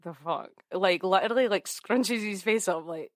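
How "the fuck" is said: muttered disbelief. Retold or imitated. Voicing [name] under breath.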